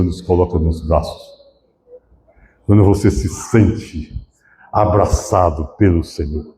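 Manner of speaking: low, gentle preaching voice; tender reassurance. Real, not acted. Pastor speaking of the Lord's embrace, tender reassurance. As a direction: drop low to comfort the flock. Preacher consoles, drops after climax.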